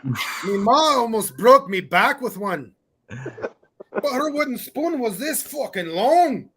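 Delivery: slow, hammed-up Scottish brogue; mock Scottish accent. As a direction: play a Scottish lad telling about his ma, lightly. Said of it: "that Scottish one sounded pretty good".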